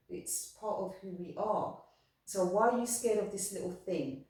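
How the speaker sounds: usual speaking voice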